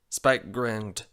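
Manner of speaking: ordinary delivery, nothing marked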